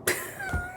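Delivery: squealing giggle of disbelief; high squeal laugh. Real, not acted. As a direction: let out a high-pitched shocked laugh. Shocked laugh at book.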